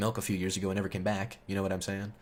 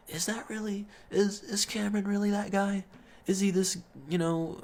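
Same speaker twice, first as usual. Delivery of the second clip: high, disbelieving question voice; incredulous question; 9 semitones higher than usual. Retold or imitated. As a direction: pitch it up in disbelief. Friends doubting a rumour together.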